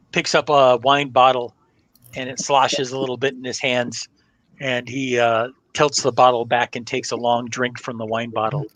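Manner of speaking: usual speaking voice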